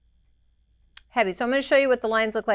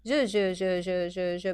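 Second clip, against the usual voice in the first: short chopped je syllables; choppy je sounds; 2 semitones lower than usual. Shown as a demonstration. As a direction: clip each stroke short. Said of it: "little choppy ones".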